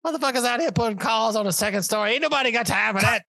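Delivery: hammed-up indignant eyewitness, voice way up; Brown. Host imitating a viral witness on a pretend emergency call, high indignant wail. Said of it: "you need what's her name".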